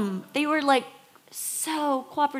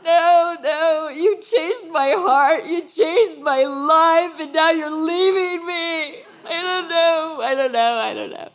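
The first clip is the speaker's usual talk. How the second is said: louder, higher tearful plea, breaking into a cry, fading out on the last words; tearful pleading; 6 semitones higher, 8 dB louder than usual. Retold or imitated. Preacher reliving a farewell to friends, tearful pleading. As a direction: beg them not to go through tears, voice cracking. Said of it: "And I was sobbing".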